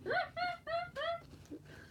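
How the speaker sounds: very high giggling laugh. Shown as a demonstration. Imagining a playlist of their laughing, high giggle. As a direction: break into squeaky, high giggles. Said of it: "me laughing".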